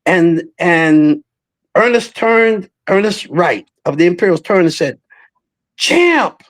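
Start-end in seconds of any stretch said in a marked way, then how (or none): 5.8-6.4 high, bright greeting call of Champ!. Retold or imitated. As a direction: hail him: "Champ!". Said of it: "That's the word he said".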